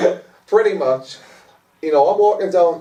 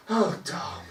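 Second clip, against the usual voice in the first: disappointed mum sigh; 5 semitones higher, 10 dB quieter than usual. Retold or imitated. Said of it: "she was just like a disappointed mum".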